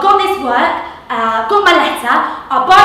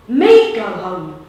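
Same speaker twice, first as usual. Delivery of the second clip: indignant disbelief; 4 semitones lower, 4 dB louder, much slower than usual. Acted. Bike man indignant.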